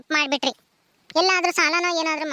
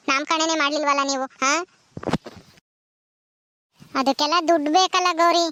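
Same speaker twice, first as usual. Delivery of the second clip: ordinary talk, nothing marked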